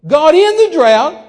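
high, slow bellowed question; incredulous shout. Retold or imitated. Guest repeating the preacher's request back, incredulous shout. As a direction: throw it back in loud disbelief.

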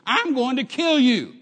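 slow, loud, high-pitched shout of a gunman; screamed threat. Retold or imitated. Hitchhiker holding a gun on the driver, screamed threat. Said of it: "he screamed at me".

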